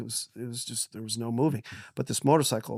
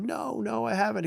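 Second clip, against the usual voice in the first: put-on dying kid; 9 semitones higher than usual. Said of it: "You're like".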